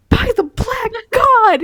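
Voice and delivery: high, loud, drawn-out outraged roar; roared curse of a furious character. A narrator's reading. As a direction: bellow the oath slowly, at full blast. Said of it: "he roared".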